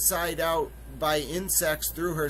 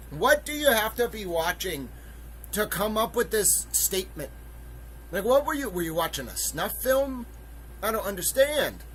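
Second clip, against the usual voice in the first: incredulous; 6 semitones higher than usual. Real, not acted. Appalled at the quote.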